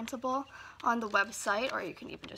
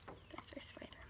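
whisper; 19 dB quieter than usual. Acted. Whispered aside.

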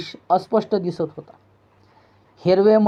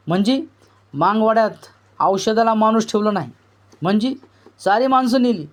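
ordinary delivery, nothing marked

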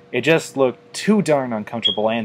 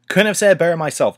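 quick, higher, louder banter; cocky quip; 7 semitones higher, 5 dB louder, much faster than usual. A narrator's reading. Tony following Rainbow down into battle, fast cocky quip.

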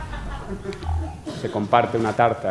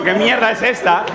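mock frightened old person; 10 semitones higher, 4 dB louder than usual. Old people startled by cold fire.

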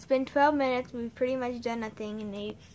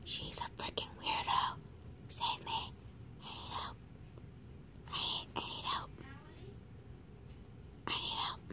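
whispered plea; 4 semitones higher, 12 dB quieter than usual. Acted. Whispering to viewers behind her friend's back. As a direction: mock-desperate whisper to the camera.

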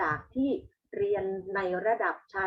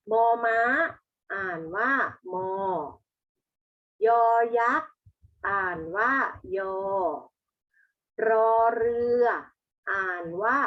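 classroom recitation; 4 dB louder than usual. Shown as a demonstration. Teacher leads pupils in reading the consonants, classroom recitation. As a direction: recite each letter name louder, then its sound.

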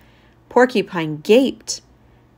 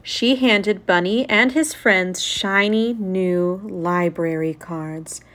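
usual speaking voice

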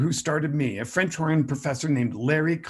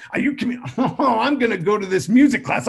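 usual speaking voice

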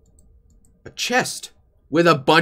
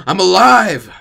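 excited shout; 6 semitones higher, 10 dB louder than usual. Acted. Joyful shout at discovering hands.

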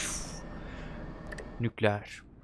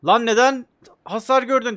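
louder, higher, annoyed telling-off of the dwellers; exasperated scolding; 6 semitones higher, 5 dB louder than usual. Real, not acted. Gamer scolding his shelter dwellers, exasperated and loud. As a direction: snap at the careless dwellers.

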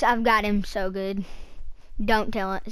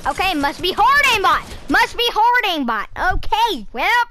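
excited; 7 semitones higher, 4 dB louder than usual. Real, not acted. Unsure the hack is on hard.

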